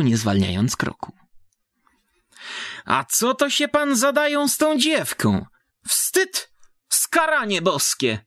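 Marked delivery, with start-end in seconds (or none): 2.8-8.2 high, shrill-pitched peasant matron scolding; scolding village woman. A narrator's reading. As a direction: scold him like a shocked old country woman. (wysoki, oburzony głos wiejskiej baby)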